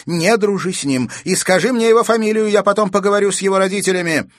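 high, loud scolding; displeased. A narrator's reading. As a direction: order the child about in displeasure. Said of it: "с недовольством спросила она". (недовольно, повышенным тоном)

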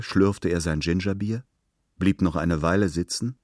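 ordinary delivery

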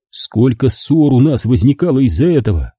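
plain reading voice

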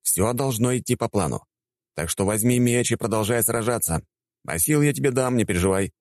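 high, insistent instructing; insistent ordering. A narrator's reading. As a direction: press him to get back to it. Narrator orders warrior to fight.

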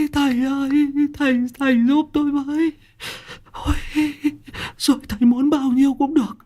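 high, fast, tearful begging; whimpering plea. A narrator's reading. Frightened man begging the master, high whimpering plea. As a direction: beg the master in a high whining voice. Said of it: "quỳ sụp xuống trước mặt của ông Cựu mếu máo".

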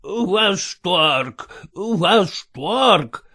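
put-on mumbling old count, toothless; toothless mumble. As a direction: gum the words like an old man. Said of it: "шамкает граф".

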